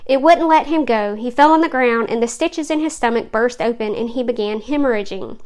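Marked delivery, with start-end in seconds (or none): none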